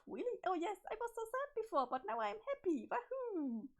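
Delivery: high, bubbly and overexcited; high-pitched manic sweetness; giddy Jane voice. Acted. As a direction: gush at Jake like a lovestruck girl gone strange. Jane keeps gushing.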